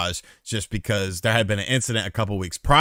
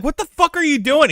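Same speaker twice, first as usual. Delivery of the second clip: high, disbelieving yelp; shocked; 3 dB louder than usual. Retold or imitated. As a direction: squeak it out in shock. Shocked at drunk friend.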